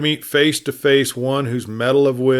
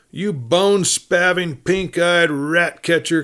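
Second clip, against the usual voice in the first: higher, relish each insult; insulting cowhand; 6 semitones higher than usual. A narrator's reading. Outlaw heaping insults on the sheriff, gleeful. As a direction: pile on the names with glee. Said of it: "continued this particular describer".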